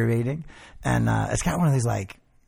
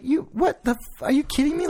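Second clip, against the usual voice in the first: laughing disbelief; 12 semitones higher than usual. Retold or imitated. Retelling shock, laughing.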